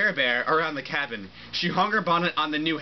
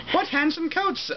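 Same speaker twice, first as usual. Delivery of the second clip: much higher, exaggerated exclamation; comically high misread of Pierre's line; overdone high read. Shown as a demonstration. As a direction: squeal the line too high then stop. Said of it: "Oh, sorry".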